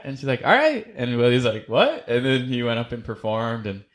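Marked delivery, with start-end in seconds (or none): 0.4-0.9 high, chirpy imitation of the host; lightly mocking high voice; mock host lady. Imitating host lady at open mic. 1.7-2.1 high, baffled what; puzzled, pitched way up; a mock bewildered squeak; mock confused. Imitating Willie's confusion.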